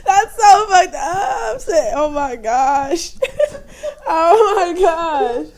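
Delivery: real laughing disbelief, drawn out slowly; helpless laughter. Real, not acted. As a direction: gasp the words out through laughter. Cracking up at shocking retold remark.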